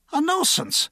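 put-on affronted drunk repeating; outraged drunk. A narrator's reading. Drunk echoes the constable's charge, affronted. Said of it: "the drunk said".

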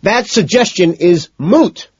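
high, loud, drawn-out outburst; exasperated scream. Acted. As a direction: scream it slowly, word by word. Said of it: "McDermott screams".

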